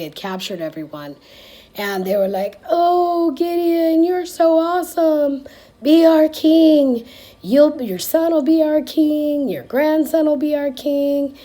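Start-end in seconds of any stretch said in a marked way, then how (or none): 2.7-11.4 high, loud, gushing adoration; fawning crowd. Retold or imitated. Grateful people begging Gideon to be king, gushing high and loud. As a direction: gush over him like starstruck fans, much higher and louder.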